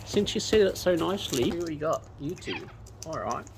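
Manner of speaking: ordinary talk, nothing marked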